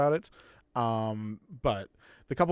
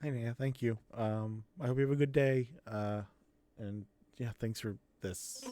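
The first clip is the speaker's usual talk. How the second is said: put-on halting, polite kid full of ums; awkward grateful kid. Kid thanking Naya as they part, awkward and hesitant.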